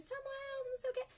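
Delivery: retold angry scolding. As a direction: snap at the boy by name, half-acted. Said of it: "eu já falei bem brava com ele".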